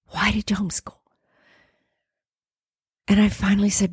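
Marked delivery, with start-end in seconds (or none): 0.1-0.9 soft, breath-only question; persistent whisper. Retold or imitated. God persisting until she admits it, whispering.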